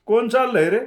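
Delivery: loud high call across the street; shouted call from a village porch. Acted. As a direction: hail a passerby from the porch.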